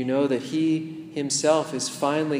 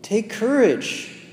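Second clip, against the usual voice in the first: much higher, slow, drawn-out encouragement; slow high call; 8 semitones higher, 3 dB louder, slower than usual. Pretend. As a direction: stretch it out like a call from afar. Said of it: "they say to you".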